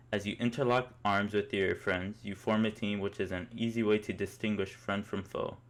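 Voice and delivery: usual speaking voice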